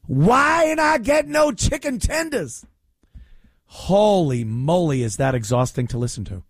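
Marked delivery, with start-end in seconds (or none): 0.1-2.6 higher mock complaining customer; imitating her voice. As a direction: whine for chicken tenders at the counter. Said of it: "Ah, same voice".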